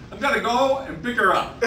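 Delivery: hammed-up proud old Italian husband; proud punchline. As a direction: deliver the punchline proudly through a laugh. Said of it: "Giuseppe proudly replied".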